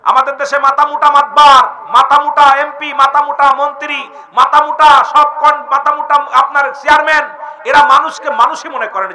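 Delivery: high, forceful sermon delivery; preaching. Acted. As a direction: drive each phrase out to the crowd.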